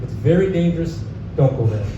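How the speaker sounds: ordinary delivery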